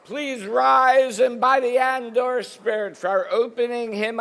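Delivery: usual speaking voice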